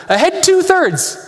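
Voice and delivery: repeated order. Retold or imitated. Navigator repeating the captain's order, high and drawn out. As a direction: echo the captain's command back. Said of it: "the navigator repeats the order".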